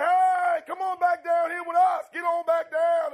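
loud shout up to the sky; turkey buddies on the ground hollering up to the eagle; calling up loudly. Acted. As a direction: holler at him to come back down.

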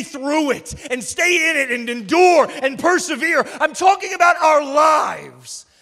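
loud, driving sermon voice with big swells; rising pulpit intensity; full-force preaching. Acted. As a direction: preach it hard to the room.

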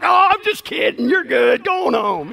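a hint of a jovial cop letting him off; teasing trooper. Trooper Andy tells the pastor he's joking, in a lightly voiced jovial tone. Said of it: "And then he's like".

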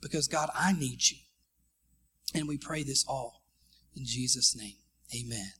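low, hushed end of a prayer; closing prayer. Real, not acted. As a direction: wind a prayer down to a low amen.

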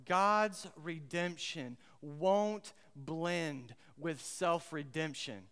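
each word laid down slowly; slow, deliberate statement. Shown as a demonstration. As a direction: spell out the big idea word by word. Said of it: "here's the big idea".

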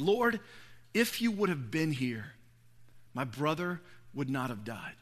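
low, hushed lament with pauses; retold quiet grief. Mary at Jesus' feet, hushed retold grief.

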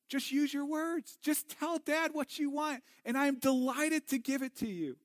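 put-on father gently coaxing his thirsty child, tender; tender dad voice. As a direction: sweet-talk a little kid into asking.

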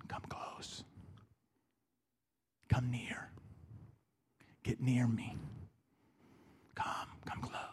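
soft breathy whisper calling someone near; voice of Jesus. Pretend. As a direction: whisper it like an intimate invitation. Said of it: "the voice of Jesus going".

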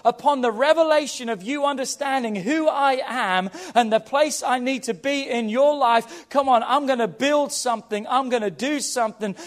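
normal talking voice

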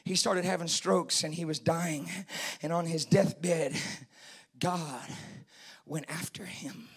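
low, very soft deathbed story; hushed preaching. Real, not acted. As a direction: tell it gravely, almost whispering at times.

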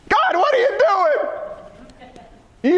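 high, slow anguished plea; imitating a cry of despair; crying out to God. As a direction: stretch it out like a desperate prayer. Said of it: "I cried out to God".

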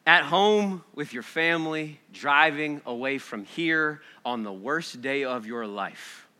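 ordinary talk

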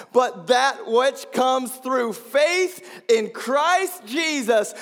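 loud, high-pitched pulpit proclamation; full-force preaching. Acted. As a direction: preach the verse out to the whole room.

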